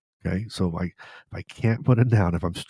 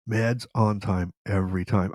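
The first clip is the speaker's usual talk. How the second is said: slow, deliberate motto, each word set apart; slow preached slogan; slower than usual. Shown as a demonstration. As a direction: preach the catchphrase slowly. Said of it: "I'm gonna keep preaching this".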